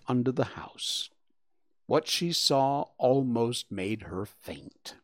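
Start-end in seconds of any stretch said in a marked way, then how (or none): none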